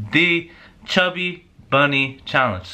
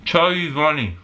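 cheeks stuffed full; 7 dB quieter, slower than usual. Real, not acted. Five marshmallows in mouth.

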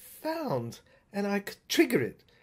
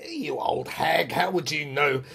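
sneering doctor; 7 semitones lower, 3 dB louder than usual. Retold or imitated. Doctor scorning the old gatekeeper, low and sneering.

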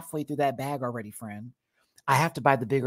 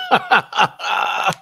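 laughing; 9 semitones higher, 8 dB louder than usual. Retold or imitated. Laughing at the cat fight.

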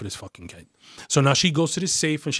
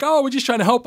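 high-pitched imitated excuse; mock protest; 11 semitones higher, 5 dB louder than usual. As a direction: mimic their excuse in a raised, high voice. Detectives' meek excuse, acted.

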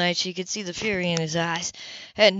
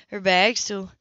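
usual speaking voice

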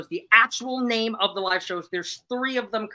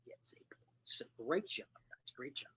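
unvoiced whisper of the point; 4 semitones lower, 18 dB quieter than usual. Real, not acted. Host murmuring the score to the chat, in a whisper. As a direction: breathe the words out softly.

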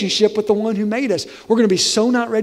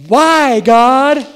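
complaining cry; 5 semitones higher, 8 dB louder than usual. Pretend. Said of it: "Next day".

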